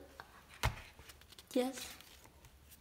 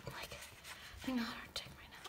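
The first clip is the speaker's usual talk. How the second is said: whispering; 10 dB quieter, faster than usual. Real, not acted. Whispered aside.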